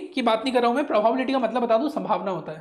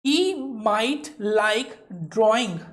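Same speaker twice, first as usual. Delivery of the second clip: very slow model reading; much slower than usual. Shown as a demonstration. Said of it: "teacher models English slowly".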